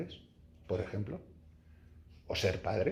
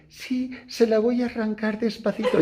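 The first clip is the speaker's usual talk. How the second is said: knowing nurse; 8 semitones higher than usual. Retold or imitated. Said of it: "se las sabía todas".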